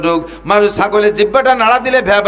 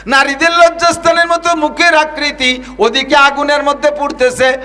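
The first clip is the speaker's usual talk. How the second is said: full-force preaching; 8 semitones higher than usual. Real, not acted. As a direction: preach it out at the top of the voice.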